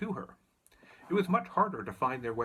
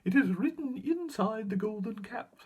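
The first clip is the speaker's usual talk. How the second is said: squeaky mouse queen; 9 semitones higher, 4 dB louder than usual. A narrator's reading. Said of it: "replied the Queen of the Mice".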